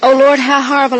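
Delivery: loud cry of horror, narrated. A narrator's reading. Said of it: "I cried".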